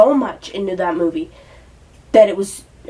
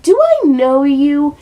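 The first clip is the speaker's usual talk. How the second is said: mock Lois; 5 semitones higher, 5 dB louder, slower than usual. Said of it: "just be like".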